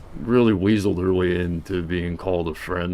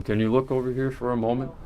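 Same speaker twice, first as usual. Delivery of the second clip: ordinary delivery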